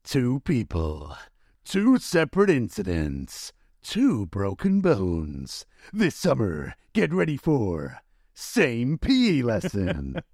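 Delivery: deep, slow trailer narrator voice; movie trailer voice. Shown as a demonstration. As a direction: boom it out like a film trailer. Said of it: "you read that in your movie voice".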